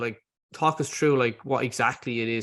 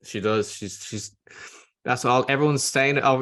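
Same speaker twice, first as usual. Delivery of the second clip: ordinary talk, nothing marked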